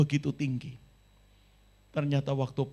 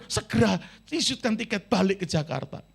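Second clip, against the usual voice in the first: higher, urgent cry to be sent home; desperate plea; 5 semitones higher than usual. Retold or imitated. Crying student phoning his father, urgently begging to fly home. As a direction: beg for the ticket like a panicked student. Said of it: "Wah, dia langsung telepon".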